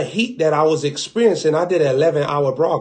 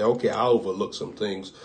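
Father overlooking; 8 semitones lower, 7 dB quieter than usual. Retold or imitated. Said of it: "the Father said".

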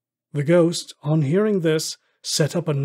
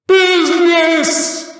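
much higher, loud, drawn-out cry; anguished cry; 14 semitones higher, 10 dB louder, much slower than usual. A narrator's reading. Marley's ghost crying out at Scrooge's words, a high anguished wail. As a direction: hurl the word out in despair. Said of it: "cried the ghost".

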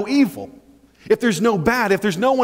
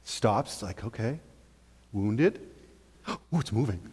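entranced hush; 7 semitones lower, 10 dB quieter than usual. Acted. Fish watching the lure, entranced.

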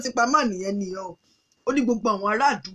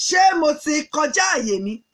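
ordinary delivery

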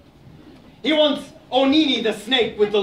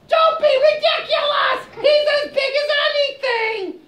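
much higher, loud indignant squeak; squeaky hedgehog; 3 dB louder than usual. Acted. Hedgehog argues with Aso, a high indignant squeak. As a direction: protest in a tiny outraged voice.

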